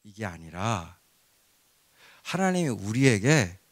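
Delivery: ordinary talk, nothing marked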